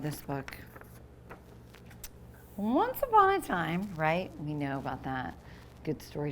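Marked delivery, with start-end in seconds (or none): 2.6-3.9 storybook opening. A narrator's reading. Storybook opening read aloud.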